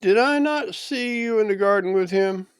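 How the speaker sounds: a servant who knows what he saw; kinsman's accusation. A narrator's reading. The cut man's kinsman confronts Kepha, high and accusing.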